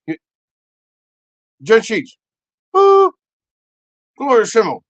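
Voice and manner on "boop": imitated automatic beep; piercing mock alert boop; high loud boop.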